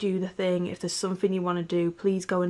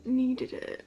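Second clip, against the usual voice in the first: tearful, higher, softer, choking up; fighting back real tears; voice breaking; 9 semitones higher, 5 dB quieter than usual. Real, not acted. Vlogger thanking fans, voice cracking with tears. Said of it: "don't know why I'm getting emotional".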